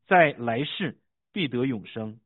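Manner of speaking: measured, emphatic Bible reading; scripture reading. A narrator's reading. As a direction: read scripture aloud, punching key phrases. Reads scripture aloud.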